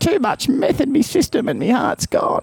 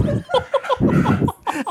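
high laugh. Real, not acted. Laughs after the outburst.

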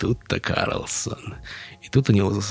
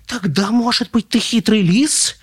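very high, frightened squeak; high, halting little voice; faltering chick; 6 dB louder than usual. A narrator's reading. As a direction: ask it timidly in a tiny high chick voice. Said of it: "заикнулась она".

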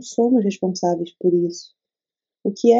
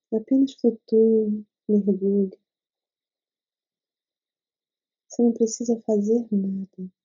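guided meditation. Acted. Leads a meditation.

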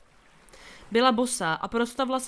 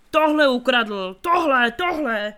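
loud repeated yell, slow at first, fading on the last; 2 semitones higher, 9 dB louder, slower than usual. A narrator's reading. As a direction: wave the fish and shout it out. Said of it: "mával rybou a křičel". (křik, opakované „Tohle!“, poslední slabší)

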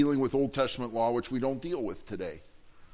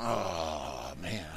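low, soft deflated groan; long, sinking sigh of defeat; disappointed fan; 11 semitones lower, 7 dB quieter than usual. Acted. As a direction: let the air out, crushed by the other team's score. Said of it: "when the other team scores a touchdown".

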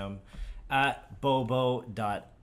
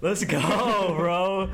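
gym bro; 10 semitones higher, 6 dB louder, much slower than usual. Pretend. Hyped friend vows revenge on bullies.

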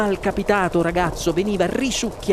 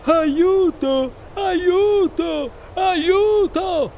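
high, put-on drowning cries; boy's howl for help; 12 semitones higher, much slower than usual. A narrator's reading. Boy drowning in chocolate, desperate yell. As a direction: yell each one separately, desperate. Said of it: "urlò".

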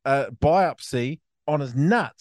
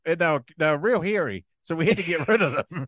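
put-on West Coast accent; 6 semitones higher than usual. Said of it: "bloody West Coast accent".

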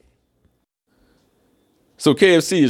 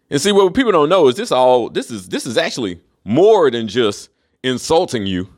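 normal talking voice

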